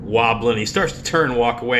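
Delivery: usual speaking voice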